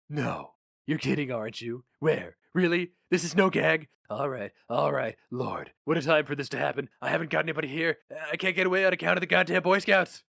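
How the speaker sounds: lightly voiced gruff newspaper boss; a hint of a jaded editor reminiscing; boss Flammer. Flammer on the phone.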